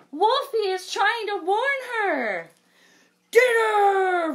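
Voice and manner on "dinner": high, loud hungry roar; roaring bear. Acted. The bear grabbing at Dot, a loud roar. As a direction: bellow it at the bunny. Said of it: "roared the bear".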